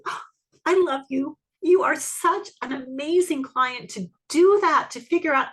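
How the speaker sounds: a client who surprised her, beaming praise; gushing delight. Retold or imitated. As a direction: gush over a star client. Gushing inner thought about client.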